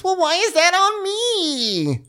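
very high, slow, whiny squeal; mock Lindsay. Co-host parodies Lindsay's outrage, very high slow whine. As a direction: squeal it like a spoiled diva.

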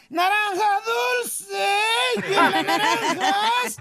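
high-pitched hawker's call, drawn-out words; street vendor's cry. Acted. Orange thief hawking stolen fruit in the street, sing-out cry. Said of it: "Y entonces gritaba".

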